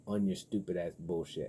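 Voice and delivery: normal talking voice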